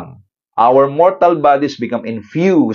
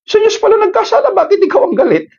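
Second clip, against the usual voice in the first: mock indignant question; much faster than usual. Preacher mocks angry churchgoers.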